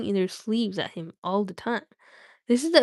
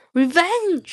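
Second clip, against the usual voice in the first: loud, higher snort of a word; snorting dragon; 8 semitones higher, 6 dB louder than usual. A narrator's reading. Streamer reading Smaug's snort aloud, loud and scornful. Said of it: "he snorted".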